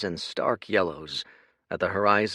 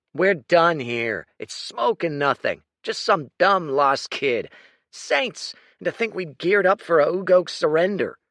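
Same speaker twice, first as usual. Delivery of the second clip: scornful lieutenant; 9 semitones higher, 7 dB louder than usual. Acted. Lieutenant dismissing the lost kid, loud and scornful.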